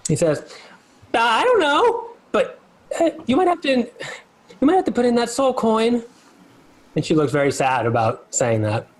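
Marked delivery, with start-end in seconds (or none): 1.1-6.0 much higher, hesitant little creature voice; Lulu's voice. Acted. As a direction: say it reluctantly, small and unsure. DM voices Lulu the NPC.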